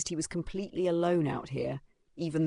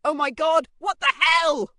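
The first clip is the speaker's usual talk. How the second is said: high, loud gasp of disbelief; shocked exclamation; 12 semitones higher, 10 dB louder than usual. Acted. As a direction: cry out in total shock. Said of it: "gasped in disbelief".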